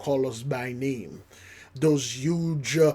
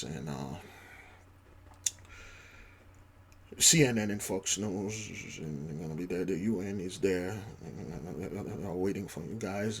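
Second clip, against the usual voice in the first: softer, lower polite plea; hushed envoy; 6 semitones lower, 12 dB quieter than usual. Pretend. Envoy softly begging Levi and Judah to come, softer. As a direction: plead politely and small. Envoy pleading quietly, acted.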